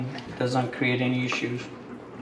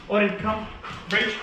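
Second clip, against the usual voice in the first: high, crisp command call; dog command; 7 semitones higher than usual. Real, not acted. Trainer calling Odin to come, high command.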